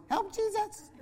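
tiny, very high, soft squeak; high little kiddie voice; little child's voice. Retold or imitated. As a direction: imitate a small child piping up. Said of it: "I would hear this little voice".

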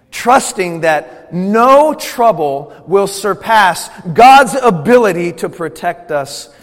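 loud, emphatic sermon voice, stretched out slowly; forceful preaching. Real, not acted. Preacher declares trust in God's protection, loud and emphatic. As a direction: proclaim it with weight on the big words.

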